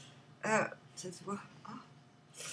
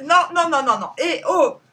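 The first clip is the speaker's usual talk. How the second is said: sudden loud, higher burst of repeated no; scolding shout; 6 semitones higher, 15 dB louder than usual. Real, not acted. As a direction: cut in sharply to stop misbehaviour. Scolding interruption, likely at her dogs. (réprimande criée)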